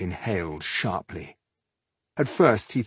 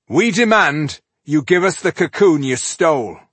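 stern demand; 8 semitones higher, 8 dB louder than usual. Acted. Tungdil confronting the stranger.

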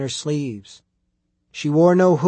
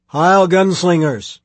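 loud, higher, drawn-out hail; called-out greeting; 4 semitones higher, 8 dB louder than usual. A narrator's reading. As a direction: hail the riders loudly, stretching the words. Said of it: "she called".

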